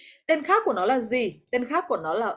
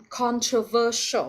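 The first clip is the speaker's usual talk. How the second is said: "controversial", slow, stressed on VER; third-syllable stress; slower than usual. Shown as a demonstration. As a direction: slowly model "controversial" as contro-VER-sial. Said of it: "nó sẽ nhấn ở âm ba này".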